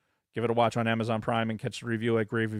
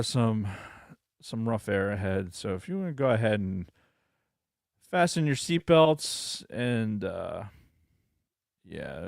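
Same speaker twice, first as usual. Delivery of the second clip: pilot announcement; 2 semitones higher, 3 dB louder than usual. Pretend. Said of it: "from the flight deck".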